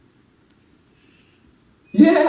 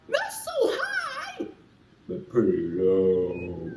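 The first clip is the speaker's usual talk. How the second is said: high then low; 12 semitones lower, 8 dB quieter, slower than usual. Shown as a demonstration. Teacher acting out high and low sounds. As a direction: squeak high, then drop low and slow. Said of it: "a really low sound".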